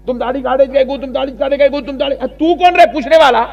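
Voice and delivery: high, loud mock scolding of beardless men; mimicking angry clerics. Preacher mocks clerics scolding men, loud mock yelling. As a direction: shout like a cleric chasing people with a stick. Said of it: "لکڑی لے لے کے".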